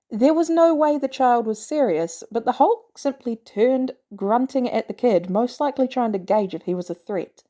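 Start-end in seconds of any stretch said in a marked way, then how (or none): none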